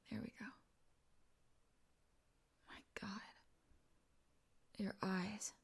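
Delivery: very soft, hushed murmur; awed whisper. Acted. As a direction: breathe it out quietly, up close. Acted awe at eye contact.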